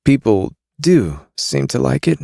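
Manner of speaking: a queasy smuggler; Carrion voice. A narrator's reading.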